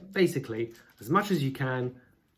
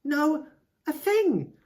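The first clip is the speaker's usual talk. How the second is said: mock viewer scoff; 9 semitones higher, slower than usual. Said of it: "if you're watching and you're going".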